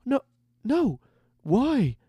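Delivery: high, broken-up pleading; distraught young recruit. A narrator's reading. Recruit falls to his knees after the killings, distraught. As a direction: ask why in a high, stricken boy's voice. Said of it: "falling to his knees".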